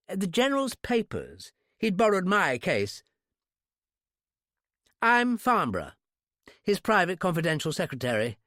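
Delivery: high, affected English; exaggerated British accent. A narrator's reading. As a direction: introduce yourself in high-class English. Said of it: "high-class English accent".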